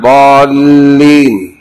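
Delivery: ضالين stretched very slowly with the lam held long; doubled lam. Shown as a demonstration. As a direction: lean on the doubled lam in ضالين, slow and loud. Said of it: "ويشدد على اللام". (شدّ اللام في ضالين ببطء)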